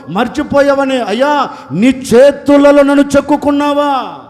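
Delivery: loud, anguished repentance; tearful plea. Acted. Weeping woman begs Jesus, loud and anguished. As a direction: cry out for forgiveness. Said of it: "పట్టుకొని ఏడుస్తుంది".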